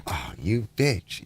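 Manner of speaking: low, slow, soft mock muttering; a slow, quiet retold jab; muttered insult. Muttering a jab at Elizabeth over the lounge chairs. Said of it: "And I was like".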